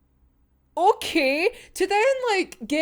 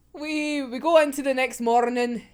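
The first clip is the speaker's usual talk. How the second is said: ordinary talk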